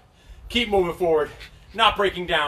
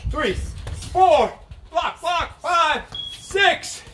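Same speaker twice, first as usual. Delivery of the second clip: boxing coach calling combos; 9 semitones higher, much slower than usual. Real, not acted. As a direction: shout the combo to the class.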